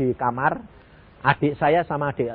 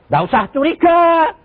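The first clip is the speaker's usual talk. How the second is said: emphatic exhortation; 12 semitones higher, 6 dB louder than usual. Real, not acted. Preacher urging the congregation, raised and loud. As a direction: rise high and loud on the point.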